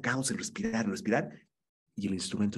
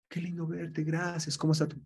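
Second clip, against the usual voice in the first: glad greeting; 7 semitones higher than usual. Shown as a demonstration. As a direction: greet him like a long-missed friend. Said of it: "cambio de la emoción de tristeza".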